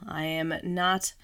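ordinary talk

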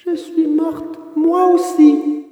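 put-on high, ghostly little girl; otherworldly little voice. A narrator's reading. Blue-haired child at the window to Pinocchio, ghostly little voice. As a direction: speak as if from the other world, small and high. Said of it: "d'une petite voix qui semblait venir de l'autre monde".

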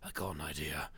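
low, hushed movie-villain imitation; imitated low, soft menace; suddenly quiet. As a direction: drop right down, quiet and deep. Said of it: "just gets all quiet and goes".

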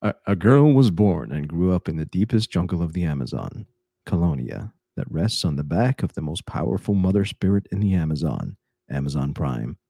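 big Hollywood trailer narrator; matinee idol. Shown as a demonstration. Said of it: "Do it in your, do it in your Hollywood voice".

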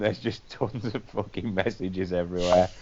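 ordinary talk

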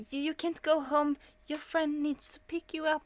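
lightly voiced nurse giving orders; mock nurse voice. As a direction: imitate the hospital staff laying down the rule. Staff telling her she can't leave.

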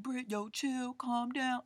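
half-serious imitation of friends trying to calm her; imitating friends telling her to calm down. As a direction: play the nervous friends begging her to chill. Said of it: "Everybody's like".

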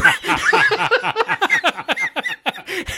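high, loud burst of laughter; real loud laugh. Real, not acted. Storyteller cracks up at his own trip, loud high laugh. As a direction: crack up at the memory.

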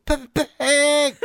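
high, drawn-out mock squeal; hammed-up childish shriek of delight; excited children. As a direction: imitate kids screaming for their idol. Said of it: "thought it was for real".

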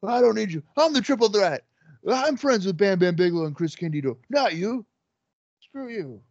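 strut and sneer like a heel; cocky wrestler promo. Retold or imitated. Imitating Shane Douglas cutting promo.

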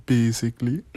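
mock Jamaican doctor, pitch rising at the end; Jamaican accent. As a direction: lilt it upward in a Jamaican accent. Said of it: "my favorite way to start".

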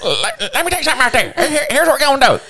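a cartoon hillbilly, high and quick; Squidbilly voice. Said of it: "You sound like the Squidbillies".

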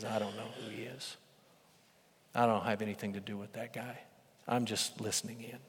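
low, very soft disowning; evasive denial. Acted. Peter disowns Jesus to questioning bystanders, quietly evasive. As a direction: back away quietly, voice dropped.

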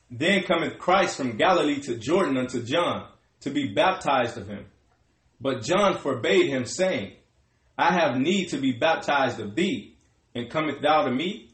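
ordinary talk

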